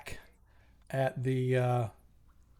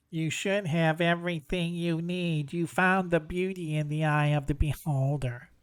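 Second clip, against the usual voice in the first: sphinx. Acted. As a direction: answer like a patient riddle-keeper. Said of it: "Will you let us pass?".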